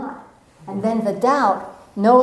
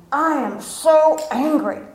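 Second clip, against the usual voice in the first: mock angry complaint; 6 semitones higher, slower than usual. Angry self pleading to divine.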